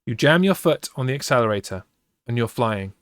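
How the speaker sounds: plain reading voice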